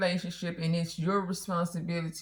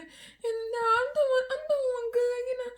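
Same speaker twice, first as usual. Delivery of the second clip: much higher, easygoing mock reply; friendly husband. Wife imitates husband chatting back to teller, much higher. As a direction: play him all chummy and pleased.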